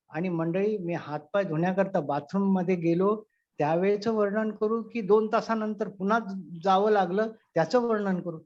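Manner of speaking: usual speaking voice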